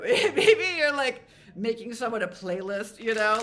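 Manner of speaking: giggling through the words, high and loud; bubbling over with a laugh. Real, not acted. Reader suggesting viewers make a playlist, laughing.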